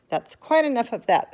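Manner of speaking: loud, stern put a stop to it; firm shopkeeper. A narrator's reading. Shopkeeper stopping the bidding crowd, firm and loud. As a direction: shut the crowd down. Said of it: "the fat shopkeeper said".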